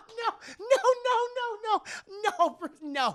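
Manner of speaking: very high voice through mock laughter; acted hysterical string of denials; hammed-up laughing denial. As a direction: laugh the words out, coming down in pitch. Selina voiced, laughing off the idea.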